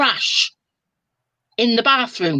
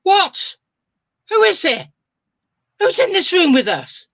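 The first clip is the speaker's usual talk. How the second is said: high, louder startled demand, held back; mock alarm, kept below a real yell; a hint of panicked shouting; toned-down alarmed shout; 10 semitones higher than usual. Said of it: "Only louder than that".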